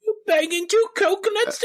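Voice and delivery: very high, squealed British comedian impression; Ricky Gervais. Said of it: "you sound like Ricky Gervais".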